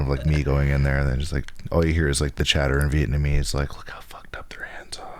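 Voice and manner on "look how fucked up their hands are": whispered, as if talking behind a customer's back; mock gossip whisper. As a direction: breathe it out as catty gossip. Salon workers gossiping about hands.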